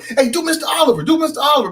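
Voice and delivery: egging him on for the bit; kids begging. Retold or imitated. Classmates begging for the Mister Oliver impression, lightly voiced. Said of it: "people just be like".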